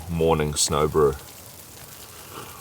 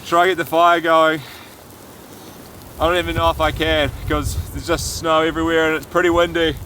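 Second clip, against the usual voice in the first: gasping in icy wind; 8 semitones higher than usual. Real, not acted. Outside in blizzard, wind.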